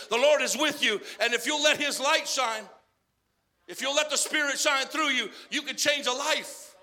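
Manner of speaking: loud, building sermon exhortation; preaching at full force. Acted. Preacher urging the church, loud and fervent. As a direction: drive it home to the congregation.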